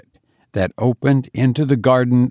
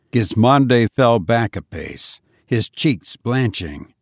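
plain reading voice

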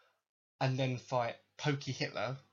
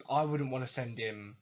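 usual speaking voice

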